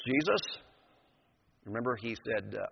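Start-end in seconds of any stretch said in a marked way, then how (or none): none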